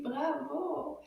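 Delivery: normal talking voice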